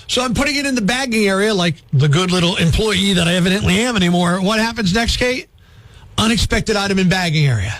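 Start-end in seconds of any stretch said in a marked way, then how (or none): none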